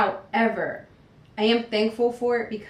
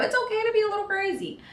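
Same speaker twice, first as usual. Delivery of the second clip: playful repeat; 12 semitones higher, faster than usual. Pretend. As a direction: say it again high and quick, half joking. Repeat of the crazy line.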